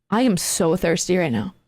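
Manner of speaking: low, fixated creepy man; eerie stranger. Retold or imitated. Jittery man staring at caller, eerie. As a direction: imitate an unsettling man in a low voice.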